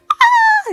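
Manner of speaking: put-on child's scream. Girl screaming from the basement in mock fright. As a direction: let out a startled little girl's shriek. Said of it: "Rudy screaming".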